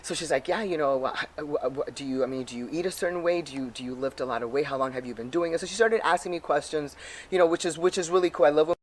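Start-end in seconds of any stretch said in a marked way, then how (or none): none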